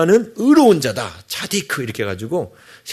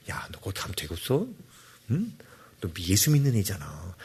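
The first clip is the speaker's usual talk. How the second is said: much softer and lower, a gentle nudge; soft inner voice; 7 semitones lower, 12 dB quieter than usual. Pretend. Preacher voicing God quietly nudging someone outside a nightclub. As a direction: murmur it like conscience. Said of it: "이렇게 소소하게 감동을 주세요".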